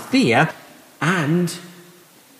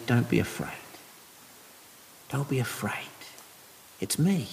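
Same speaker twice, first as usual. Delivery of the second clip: a hushed, gentle comfort; softly calm frightened friends; hushed, tender words of comfort; soft reassurance; 5 semitones lower, 11 dB quieter than usual. Acted. Jesus comforting women, soft.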